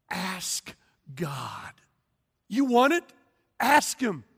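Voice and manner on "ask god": soft hushed whisper; preacher urging the congregation to ask God, whispered. Real, not acted. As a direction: lean in and whisper it. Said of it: "preacher urges softly".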